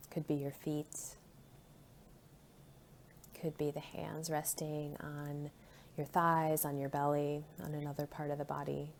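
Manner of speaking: soft, calm meditation voice with long pauses; hushed, soothing body-scan guidance; guided meditation. Real, not acted. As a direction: settle listeners gently into their bodies, unhurried.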